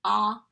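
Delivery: ah said short and loud like the o in octopus; short o sound. Shown as a demonstration. Teacher sounding out the short O for children, loud ah. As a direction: drop the jaw for a loud open ah. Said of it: "The letter O".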